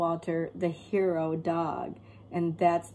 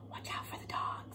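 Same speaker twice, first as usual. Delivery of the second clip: burglar's tense whisper; whispered warning to his partner; 14 dB quieter, faster than usual. Acted. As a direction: hiss it like a sneaking thief. Burglars sneaking in.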